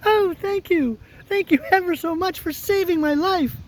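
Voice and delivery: very high, tiny squeak; squeaky mouse. Acted. Field mouse thanking the woodman for saving her, squeaky voice. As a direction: pipe up like a grateful little mouse. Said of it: "in a squeaky little voice".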